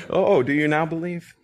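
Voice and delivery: laughing through a gentle question; amused question. Shown as a demonstration. Preacher showing Jesus's question as fond, not chiding, laughing. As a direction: let a chuckle carry the words. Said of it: "don't hear that in my voice".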